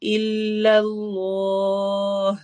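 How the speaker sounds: الا اللہ very slow with a full heavy lam. Shown as a demonstration. Teacher reciting for the class, heavy lam. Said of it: "اللہ کا لام کو ہم موٹا کر کے پڑھیں گے".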